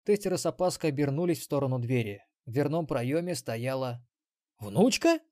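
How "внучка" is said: high, rising disbelief; stunned. Acted. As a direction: blurt it out in shock. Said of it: "опешил Ксенобайт".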